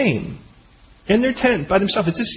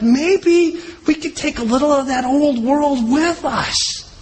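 scheming Ham; 5 semitones higher, slower than usual. Retold or imitated. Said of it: "Ham's kinda laying this whole thing out".

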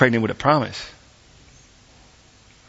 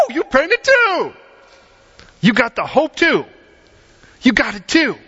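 joyful welcome; 11 semitones higher than usual. Retold or imitated. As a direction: cheer them in. Church greets newcomer joyfully.

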